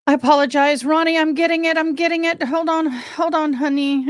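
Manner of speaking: high, strung-out pleading to hold on; hurried high-pitched apology. Real, not acted. Streamer stalling her waiting co-host Ronnie, high hurried apology. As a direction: rush out a high, placating apology.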